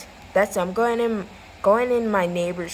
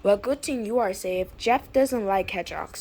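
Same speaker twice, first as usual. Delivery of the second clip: ordinary talk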